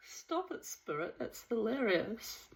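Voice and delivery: soft, breathy amused aside; laughing whisper. Real, not acted. Reader shushing a spirit, soft laughing whisper. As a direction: whisper through the giggles. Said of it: "speaking to a spirit while laughing".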